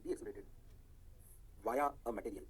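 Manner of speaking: slow, low, measured note dictation. Real, not acted. Teacher dictating electron notes to students, slow dictation. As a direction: dictate slowly in short chunks with long pauses.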